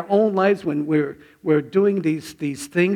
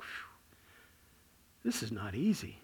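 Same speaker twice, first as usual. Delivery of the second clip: relieved sigh; 5 semitones lower, 13 dB quieter than usual. Retold or imitated. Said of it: "I'm kinda like".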